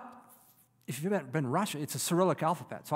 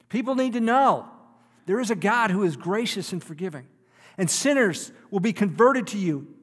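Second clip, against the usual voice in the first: loud, high, pleading sermon voice; fiery preaching; 5 semitones higher, 8 dB louder than usual. Acted. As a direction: preach it with full force, crying out to God. Preacher at full force.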